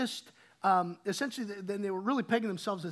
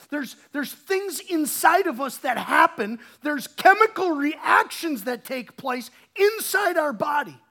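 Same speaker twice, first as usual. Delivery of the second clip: louder, pressing preacher's push; preaching at full force; 3 semitones higher, 8 dB louder than usual. Acted. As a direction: build intensity on each question. Preaching hard on love's meaning.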